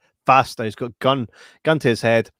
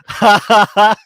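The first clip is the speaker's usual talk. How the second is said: mock laugh; 8 semitones higher, 9 dB louder than usual. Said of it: "I was like".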